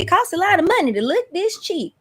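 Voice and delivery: high, sing-song country star impression. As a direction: quote her in a high, playful lilt. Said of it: "Y'all know how [name] always said".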